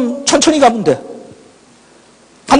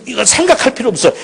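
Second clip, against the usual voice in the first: ordinary talk, nothing marked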